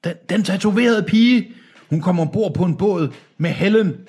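high-pitched, anxious elder voice; frightened old man. Acted. Old man explaining himself to Deacon, high frightened voice. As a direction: answer in a high, cowed voice.

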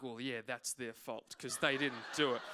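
low, offhand self-excuse; dismissive self-justifying. Retold or imitated. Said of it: "And I'm like".

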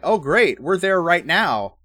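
loud, high, swooping enthusiasm; mock eager reply. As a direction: play an overexcited team reporting in. Team's eager reply to surveillance.

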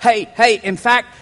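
ordinary delivery

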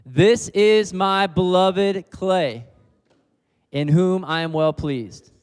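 loud, slow and high, each word stretched out; loud slow declaration. Shown as a demonstration. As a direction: proclaim it aloud to the whole room. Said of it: "So we're gonna say this out loud".

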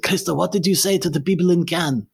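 high-pitched voice mimicking the French teacher; French teacher's voice. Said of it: "and he said".

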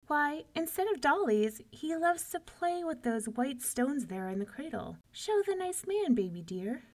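high, sugary doting mother; beaming. A narrator's reading. Una showing off baby to giant, beaming. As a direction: gush proudly over your baby.

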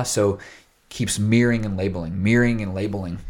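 ordinary talk, nothing marked

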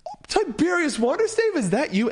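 high and eager, spotting his hero; excited fan. Acted. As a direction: run up thrilled to meet the hero.

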